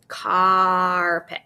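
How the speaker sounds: "Carpet" with a long held ah in car; drawn-out vowel. Shown as a demonstration. Teacher showing pupils what the A says, drawn out. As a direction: stretch the "car" of "Carpet", then a quick pet. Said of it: "this A is gonna say ah".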